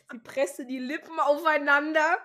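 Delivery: giggling through the words; reading aloud while laughing. Real, not acted.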